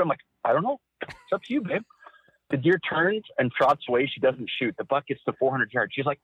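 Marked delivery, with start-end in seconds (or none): 0.4-1.8 teasing mock shrug. Mocking back her indecision.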